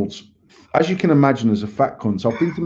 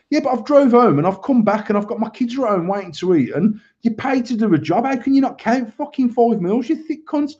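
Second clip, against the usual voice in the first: 1 retold angry rant; 8 semitones higher than usual. Retold row at McDonald's.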